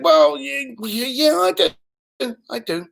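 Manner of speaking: imitated caller warming up a little, hesitantly; slight lift. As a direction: let a touch of interest creep in. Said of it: "Slight deviation in tone".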